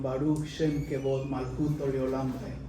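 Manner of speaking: hushed prayer. Retold or imitated. Children answer the prayer.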